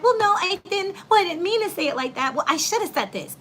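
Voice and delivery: high-pitched flustered protest; defensive backpedaling. Retold or imitated. Imitating someone whose words got twisted, defensive backpedaling. Acts out backpedaling after being misquoted.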